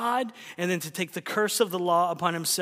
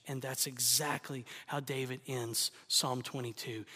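much softer, lower, near-whispered sermon voice; hushed preaching; 7 semitones lower, 11 dB quieter than usual. Acted. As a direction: drop to a hush over the cross.

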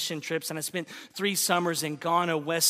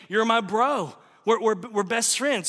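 buddy talk; 5 semitones higher, 5 dB louder than usual. Retold or imitated. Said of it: "It's just them saying".

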